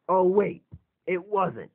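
usual speaking voice

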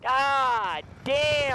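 high, loud cheer after winning; excited shout. Real, not acted. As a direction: yell it out in triumph. Celebrating a won fight.

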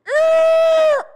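very loud, piercingly high skidding screech; imitated screech. Car nearing the telephone pole, loud screeching halt. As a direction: shriek like tires stopping just short of a crash. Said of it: "and it goes".

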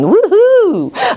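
high, loud happy whoop; cheering whoop. Real, not acted. Teacher cheering students on, high loud whoop.

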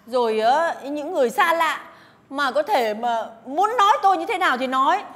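loud, high distressed protest; indignant outburst. Acted. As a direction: defend her child fiercely. Bức xúc vì con bị ảnh hưởng.

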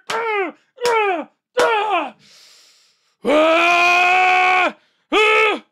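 high grunts breaking into loud screams; wordless high screams of fury; worked-up yells. Real, not acted. Streamer worked up after ranting, wordless screaming. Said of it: "I'm getting worked up here".

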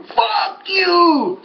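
very high, strained mock screaming; imitated furious yell. Beaten rival curses across the track, imitated high yelling. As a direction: screech the curses, drawn out, without full volume. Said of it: "he yells in front of everybody".

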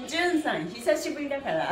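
usual speaking voice

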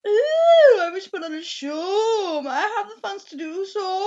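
high, loud theatrical showman. Acted. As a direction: announce it like a circus showman. Showman character pitch.

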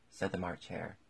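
usual speaking voice

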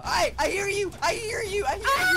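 high, loud yelling that climbs; escalating high-pitched yell; frantic shouting. Real, not acted. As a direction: shout over your teammate, rising to a shriek. Yelling back at teammate in game.

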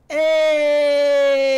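high shouted hey sliding down the hill; loud gleeful whoop. Shown as a demonstration. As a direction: yell Hey! like racing down on skates.

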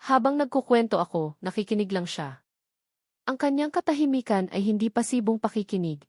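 usual speaking voice

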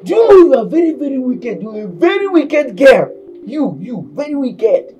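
high, loud scold. Acted. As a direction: scold her as wicked. Scolding maid.

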